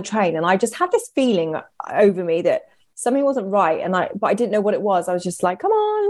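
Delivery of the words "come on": very high-pitched pep talk to self; high, sing-out self-coaxing; self-encouragement. Retold or imitated. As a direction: coax yourself along in a high voice. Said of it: "trying to sort of pick myself up".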